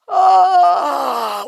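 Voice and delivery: sudden high startled cry; waking cry. A narrator's reading. Saul waking up with a startled cry. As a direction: jolt awake with a loud cry.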